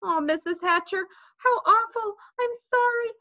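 put-on high girlish wail, climbing; crying apology. A narrator's reading. Sheila apologises to Fudge's mother, crying. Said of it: "Sheila cried".

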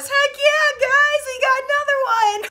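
high, loud celebratory cheer; gleeful Oompa Loompa. Acted. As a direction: cheer like a gleeful little helper. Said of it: "Oompa Loompas".

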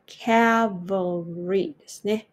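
Cavalry drawn out slowly, each sound clear; slow demo word. Shown as a demonstration. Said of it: "まずこの単語". (ゆっくり丁寧にCavalry)

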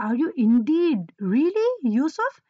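lightly voiced stunned question, rising on really; emphatic disbelief. Brothers asking Yusuf in disbelief, emphatic and incredulous. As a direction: ask it as if you can hardly believe it. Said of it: "asking with so much emphasis".

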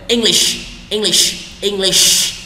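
English with a strong, hushing final sh; pouted SH. Shown as a demonstration. Teacher drilling learners on the S sound, pouted sh. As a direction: finish English on a pouted, lingering sh. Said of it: "Chu cái mỏ của bạn ta".

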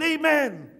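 high, rounded-off sermon amen; mock preacher's amen. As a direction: close the acted sermon emphatically. Preacher husband ends his sermon.

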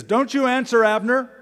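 loud taunting call across the valley. Acted. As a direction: shout it out from hiding, high and loud. David shouting from hiding.

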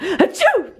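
high-pitched pretend sneeze; fake sneeze. Friend does their best sneezing impression, fake sneeze. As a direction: act out a quick achoo. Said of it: "best sneezing impression I could do".

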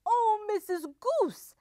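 high, coaxing storybook wolf; sweetly. A narrator's reading. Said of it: "said Big Bad Wolf sweetly".

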